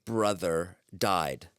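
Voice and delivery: slow, deliberate, flat reading of the note; deadpan reading. Retold or imitated. Receptionist reading her blunt message aloud, very slowly. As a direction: spell it out word by word. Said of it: "I wrote".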